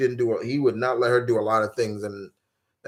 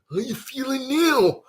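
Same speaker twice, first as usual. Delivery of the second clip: mock taunt; 13 semitones higher than usual. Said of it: "he had to watch".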